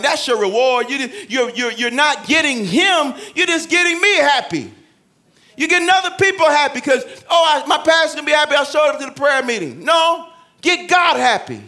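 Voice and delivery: loud, high, driving preacher; full-force preaching. Acted. Pastor urging the church to pray in private, loud and high. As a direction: preach it out to the congregation.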